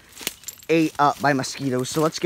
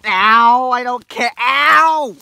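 loud pained cries; tripping and falling; 8 semitones higher, 9 dB louder, much slower than usual. Real, not acted. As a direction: yell out as you fall. Falling again, crying out.

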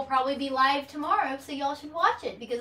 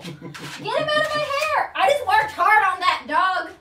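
exasperated shout; 8 semitones higher, 8 dB louder than usual. Real, not acted. Dog messing her hair.

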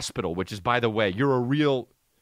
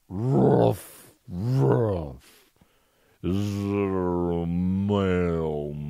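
lightly voiced, lower dopey dog talking; dog's inner voice; 8 semitones lower, much slower than usual. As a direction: play the dog pretending all is well. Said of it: "and he's just like this".

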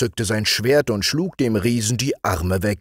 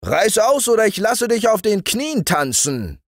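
shouting; 12 semitones higher than usual. A narrator's reading. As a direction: yell the threat up at him. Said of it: "schrie ihm der Hirt zu".

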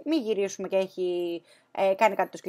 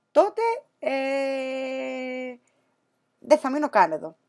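exasperated threat; 3 semitones higher than usual. Retold or imitated. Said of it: "του λέω".

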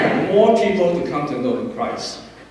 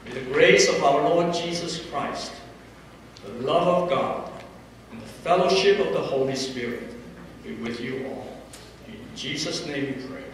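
measured, reverent benediction; solemn closing blessing; 4 dB quieter than usual. Acted.

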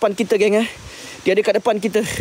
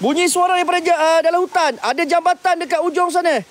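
alarmed report; 12 semitones higher than usual. Real, not acted. Alarmed description.

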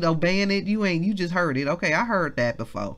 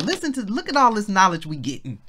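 ordinary talk, nothing marked

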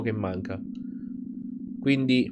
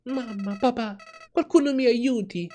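put-on high, panicked child calling for mum and dad; frightened cry for help; 12 semitones higher than usual. Child waking as a flower cries for help, frightened. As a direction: call out for help, scared and alone.

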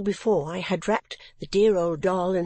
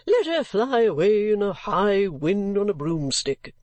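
louder, gruff dismissive outburst; gruff gentleman; 3 dB louder than usual. Acted. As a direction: wave her off with gruff scorn.

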